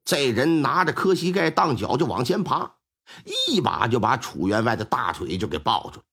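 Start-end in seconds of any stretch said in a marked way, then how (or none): none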